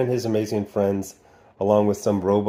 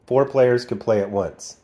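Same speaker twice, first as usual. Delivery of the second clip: ordinary talk, nothing marked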